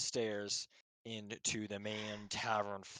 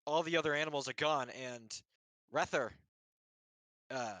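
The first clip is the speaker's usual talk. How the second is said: lightly voiced stable boy; 3 semitones higher than usual. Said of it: "he's like".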